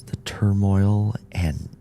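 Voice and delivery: ordinary delivery, nothing marked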